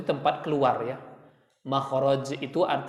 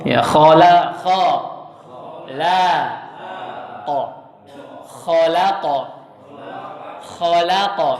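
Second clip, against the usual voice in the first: much louder syllable drill on khala; 4 dB louder, slower than usual. Shown as a demonstration. Teacher drilling khalaqa syllable by syllable, loud. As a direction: call out the syllables for the class. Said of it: "drilling khalaqa louder".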